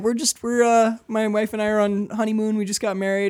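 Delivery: high, meek explaining; nervous appeasing. Acted. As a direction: plead harmlessly with the cop.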